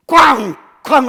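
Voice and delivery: shouted crash of the staff; loud bang. Acted. Moses striking the rock, shouted bang. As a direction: yell the bang like a blow.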